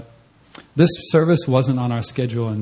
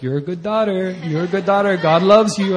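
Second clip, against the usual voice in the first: higher, tentative, holding back; hesitant blessing; 7 semitones higher, 5 dB louder than usual. Retold or imitated. Teller telling a girl she's loved, higher and hesitant. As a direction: say it unsure, eyes shut. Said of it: "I was like".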